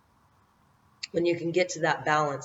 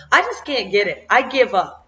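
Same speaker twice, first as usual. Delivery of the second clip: exasperated giving up; 5 semitones higher, 7 dB louder than usual. Retold or imitated. Finally giving up on task.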